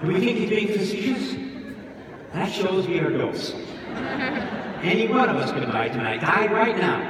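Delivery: loud, pressing sermon; fervent preacher. A narrator's reading. Priest warning mourners of sudden death, loud fervent sermon. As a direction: drive the point home loudly. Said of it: "Priest goes on".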